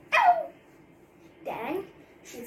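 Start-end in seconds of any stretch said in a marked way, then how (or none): 0.1-0.5 a sharp high loud yelp; high disgusted shriek. Acted. Wife recoils from the old woman with a high shriek.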